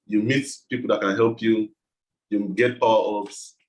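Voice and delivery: ordinary talk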